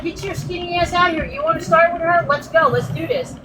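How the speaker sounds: fired-up retold call-out; retold challenge. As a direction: egg her on to fight, as a retelling. Retelling her challenge before a fight.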